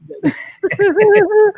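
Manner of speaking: sudden high laugh; burst of laughter. Real, not acted. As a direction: crack up loudly at the joke. Joking about romance.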